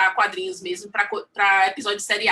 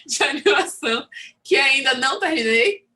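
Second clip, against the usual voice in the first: amused, words breaking into laughter; laughing admission; 2 semitones higher, 3 dB louder than usual. Real, not acted. Artist confessing she never finished her animation courses, laughing. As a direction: talk through a real laugh.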